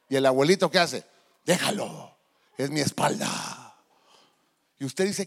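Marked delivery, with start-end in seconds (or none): 1.5-3.7 lightly voiced old man, lower and slow; a hint of a contented old grandfather; indulgent grandpa. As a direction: shrug it off for his granddaughter. Grandpa waves off mother's worry.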